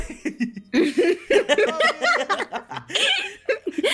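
long, high-pitched laughter; real laughing fit. Real, not acted. Player cracks up at the glitch, laughing. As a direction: laugh helplessly for several seconds.

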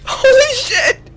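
high, laugh-broken holy shit. Real, not acted. Streamer to chat, overwhelmed, laughing it out. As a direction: blurt it through a burst of laughter. Laughing after wild moment.

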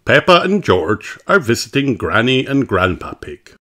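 plain reading voice

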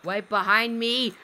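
ordinary talk

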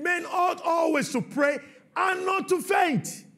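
high, loud shouted sermon delivery; fiery preaching. Acted. Preacher urging the church not to give up, shouting. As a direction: preach it hard at the congregation.

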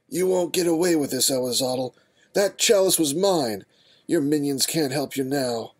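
fierce heroic challenge. Acted. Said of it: "with a fierce scowl".

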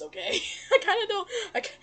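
normal talking voice

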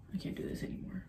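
soft, defeated whisper; exhausted whisper. Real, not acted. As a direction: give up in a whisper. Worn out by scary video.

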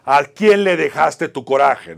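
booming, drawn-out shout, high in pitch; full-force theatrical yell, stretched out. Retold or imitated. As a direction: project it hard and slow like a confronting inner voice. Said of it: "Y subía la voz".

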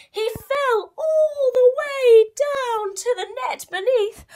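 high, loud and slow; slow high dramatic fall. A narrator's reading. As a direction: tell the fall with a cry. Mouse falling.

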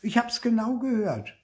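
put-on high-pitched child's accusation; high, indignant retort; indignant insistence. A narrator's reading. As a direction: insist like a tattling little sibling. Child narrator accusing sister.

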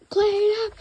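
high, loud frantic call; frantic panicked order; panicked cry. Acted. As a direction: shout it in a panic. Attendant panics at the spilled drink.

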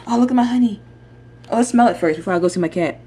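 normal talking voice

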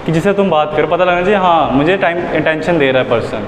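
usual speaking voice

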